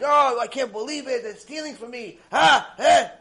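high, frantic acted yelling; losing his mind. Guest accuses hosts of stealing, acted frantic screaming. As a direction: wail the accusation in hammed-up panic. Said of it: "he starts losing his mind".

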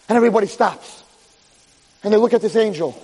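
ordinary delivery

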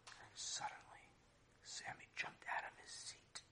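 slow hushed whisper building tension; whispered suspense. A narrator's reading. Storyteller hushing the children before Sammy's outburst, whispered. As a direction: lean in and whisper it, slowly.

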